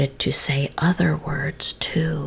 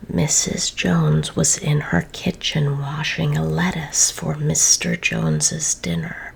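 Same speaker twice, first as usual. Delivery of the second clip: ordinary delivery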